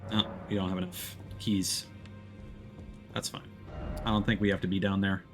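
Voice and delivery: ordinary delivery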